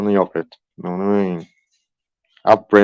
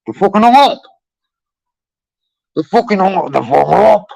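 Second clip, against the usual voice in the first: indignant shout; 9 semitones higher, 5 dB louder than usual. Real, not acted. Outraged that scammers hung up.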